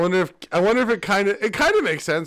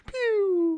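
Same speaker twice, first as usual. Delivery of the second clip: high squeaky pew with a laugh; mock pew; 3 dB quieter than usual. Mocking the weak fireball with a squeaky pew. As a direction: make a tiny pathetic pew sound.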